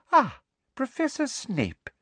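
high, sweet, simpering woman's voice; sugary Umbridge. A narrator's reading. Umbridge greeting Snape with a wide false smile, high and sugary. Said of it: "smiling widely".

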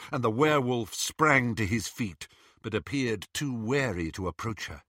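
ordinary delivery, nothing marked

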